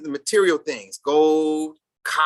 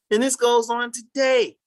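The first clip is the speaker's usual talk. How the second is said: indignant shout; 8 semitones higher than usual. Real, not acted. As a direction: raise it up, outraged it continues. Indignant point to the class.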